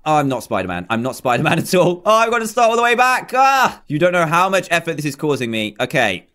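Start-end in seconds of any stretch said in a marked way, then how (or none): 3.3-3.8 frustrated yell. Real, not acted. Restarting the hard jump.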